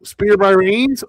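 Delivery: lively ringside play-by-play; wrestling commentator. Real, not acted. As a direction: call the moves as they happen. Live play-by-play of match.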